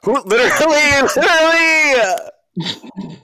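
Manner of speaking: high, slow, laughing literally; laughing disbelief. Real, not acted. Laughing along at the slavery comeback, high and stretched out. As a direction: squeal it through laughter.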